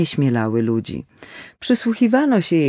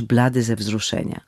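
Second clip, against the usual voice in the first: ordinary delivery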